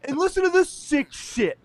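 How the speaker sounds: high, loud mock outrage, near a shout; a shocked anchor hamming up the horror; mock appalled TV host. Hosts denouncing the podcast's sketch, in high, loud mock outrage. Mocking Today Show hosts on air.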